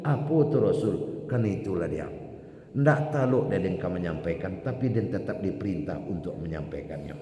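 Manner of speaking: normal talking voice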